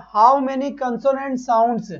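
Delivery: teacher slowly unpacking a question; slow reading. Shown as a demonstration. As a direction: question read very slowly, word by word. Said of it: "ये question क्या कह रहा है".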